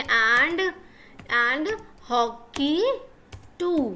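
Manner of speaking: very slow, drawn-out dictation; dictating. Shown as a demonstration. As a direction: stretch each word, pausing while writing. Dictating the sentence while writing.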